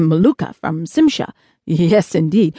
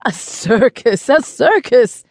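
gleeful exclamation, rising higher on the repeat; laughing glee; 5 semitones higher, 3 dB louder, slower than usual. A narrator's reading. Chubby spaceman delighted by a coming circus, gleeful. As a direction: cry CIRCUS with bubbling anticipation. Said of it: "laughed Mel, clapping his chubby hands".

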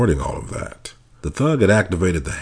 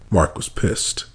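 plain reading voice

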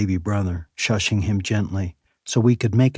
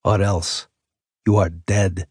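put-on dark, menacing Russian accent; a final threat in a thick Russian accent; slower than usual. A narrator's reading. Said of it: "he whispered with a thick Russian accent".

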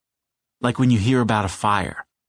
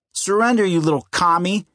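mock tough-guy taunt; 7 semitones higher than usual. A narrator's reading. Eddie playing tough guy.

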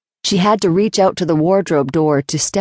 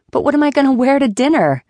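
high, fast flustered woman; Flora's voice; 9 semitones higher, faster than usual. A narrator's reading. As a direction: thrown and anxious, rushing.

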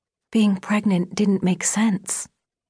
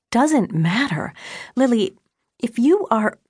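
incredulous echo; 6 semitones higher than usual. A narrator's reading. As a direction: throw it back in disbelief, pitch raised. Mother echoes daughter in disbelief.

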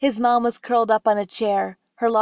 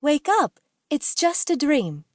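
higher-pitched call at ordinary volume; understated holler, raised in pitch; mild holler; 6 semitones higher than usual. A narrator's reading. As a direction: call out to a sleeper, restrained. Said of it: "she hollered".

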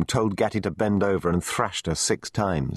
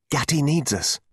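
high-pitched appeal for help; urgent cry; 7 semitones higher than usual. A narrator's reading. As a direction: cry out on a friend's behalf. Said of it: "I cried".

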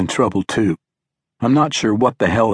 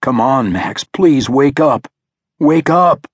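high, urgent plea, building louder; desperate pleading; 5 semitones higher, 4 dB louder, slower than usual. A narrator's reading. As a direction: beg your son to wake, voice rising. Father begging unconscious son to wake.

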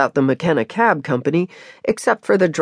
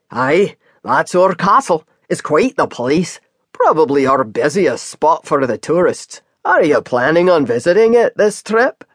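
Scottish cab driver. Acted. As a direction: chat to the newcomer like an easygoing Scottish driver. Scottish driver voiced by reader.

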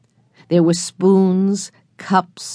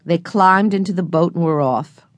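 usual speaking voice

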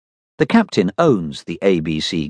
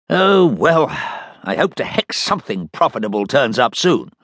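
captain's voice; 8 semitones higher than usual. Acted. Captain complains about profits.

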